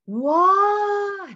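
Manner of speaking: high, loud, drawn-out storytelling wow; intonasi tinggi. Shown as a demonstration. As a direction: exclaim high and long to hook a child. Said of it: "nah itu kan ada intonasi yang tinggi". (seruan takjub bernada tinggi untuk menarik anak)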